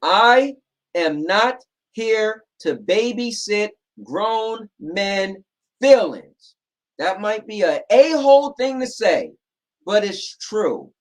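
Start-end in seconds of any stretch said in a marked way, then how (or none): 0.0-6.5 very slow and louder, every word weighted; slow, deliberate emphasis. Real, not acted. Telling viewers he won't babysit them, slow and emphatic. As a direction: lay it down word by word so it sticks. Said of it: "Guys, hear me out".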